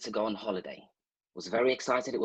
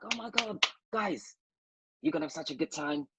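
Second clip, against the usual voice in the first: high, softer gush of mock excitement; excited mom; 4 semitones higher, 3 dB quieter than usual. Mom to guys before their holiday, excitedly imitated. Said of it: "My mom's all excited".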